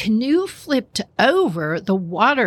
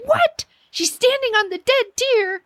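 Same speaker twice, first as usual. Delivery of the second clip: much higher, shocked disbelief. Retold or imitated. Friend reacts to news of the dead deer, high shocked squeal. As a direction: shriek it in disbelief.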